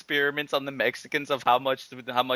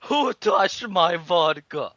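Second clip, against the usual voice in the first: slow, loud, high demand; hammed-up slow Russian demand; mock angry Russian; 6 semitones higher, 5 dB louder, much slower than usual. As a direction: growl it out like a drunk defending his bottle. Russian demands his vodka.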